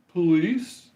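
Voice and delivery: high, tentative call of police; a cop checking if anyone's there; questioning cop call. Retold or imitated. Cop returning, calling out high and unsure. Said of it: "he's like".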